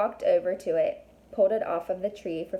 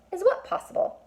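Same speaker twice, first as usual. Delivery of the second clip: puzzled question; 12 semitones higher than usual. A narrator's reading. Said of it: "Connor asked".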